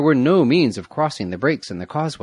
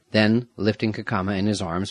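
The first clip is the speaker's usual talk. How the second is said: plain reading voice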